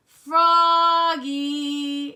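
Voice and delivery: long, high, loud singsong bellow; dad yelling. A narrator's reading. Dad hearing the ball thump, long loud yell. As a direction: stretch the name out at full volume. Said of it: "yelled Dad".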